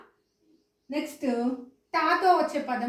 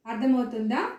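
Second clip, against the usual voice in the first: ordinary delivery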